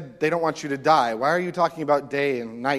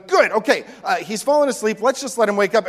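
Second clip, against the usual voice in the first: higher, a bit louder relieved disciple voice; mock relieved disciples; 8 semitones higher, 8 dB louder than usual. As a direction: play the disciples as relieved and missing it.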